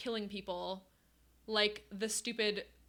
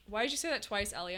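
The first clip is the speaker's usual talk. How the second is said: ordinary talk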